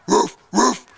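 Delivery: short imitated ruffs; two pretend dog barks; dog barking. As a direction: show a pup how to bark. Mama demonstrates barking to George.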